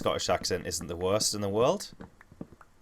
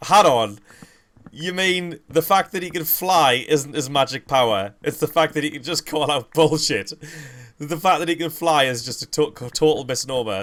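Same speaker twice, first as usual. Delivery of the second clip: loud, raised, incredulous retort; Ricky Gervais; 6 semitones higher, 7 dB louder than usual. Retold or imitated. Streamer retelling the comic's objection, loud incredulous imitation. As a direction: imitate a comic loudly picking apart nonsense. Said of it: "Ricky Gervais is like".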